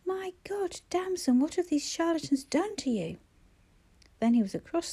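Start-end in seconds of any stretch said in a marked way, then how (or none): 0.0-3.2 high, stunned cry of her name; horrified at what he sees; a brother in disbelief at his sister's state; shocked. Acted.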